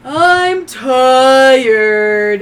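sudden loud sleepy bellow; dopey cartoon bellow. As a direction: boom it out like a waking giant. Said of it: "goes live all at one second and goes".

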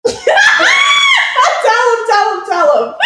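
laughing and shouting, high and loud. Real, not acted. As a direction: egg your friend on through laughter. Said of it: "laughing, urging friend to tell".

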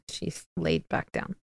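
soft whisper so the cat isn't disturbed. Real, not acted. Speaker watches the cat settle and whispers so as not to disturb it. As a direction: hush it, barely a breath.

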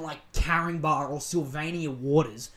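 ordinary talk

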